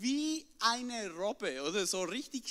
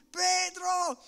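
high, loud excited call; calling out excitedly; 9 semitones higher, 5 dB louder, slower than usual. Retold or imitated. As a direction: shout across the water to Pedro. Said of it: "hab gerufen".

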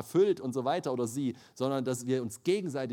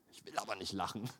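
mumbled sulky refusal; 7 semitones lower, 9 dB quieter, faster than usual. Retold or imitated. Grumbling refusal, laugh breaks in.

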